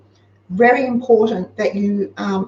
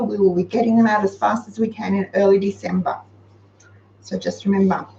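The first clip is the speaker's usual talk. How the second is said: ordinary delivery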